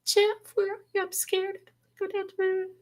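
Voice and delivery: high, soft frightened woman's voice; scared lady. Retold or imitated. Other lady calling to her husband Jeff, frightened and high. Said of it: "she goes".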